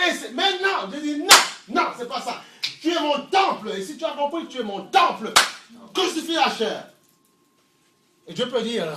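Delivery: loud, high pulpit fervour; full-force preaching. Acted. Preacher rebuking religious hypocrisy in loud fervour. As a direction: preach it out to the crowd.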